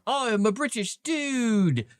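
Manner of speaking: hammed-up British accent, high and slow; mock British accent. DM mocking movie actors' put-on voices, in a slow, high, hammed-up British accent. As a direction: drawl it like a cartoon British bloke. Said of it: "they're like".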